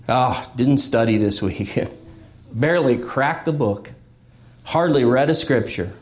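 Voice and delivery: low, deflated confession of a bad week; a lightly voiced believer sighing over a bad week; self-pitying believer. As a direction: mope through the excuses, voice sunk low.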